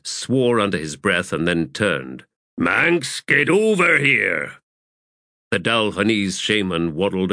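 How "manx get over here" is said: higher, drawn-out summons; calling out. Acted. Corporal to the shaman across the crates, calling out. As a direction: call him over with drawn-out words.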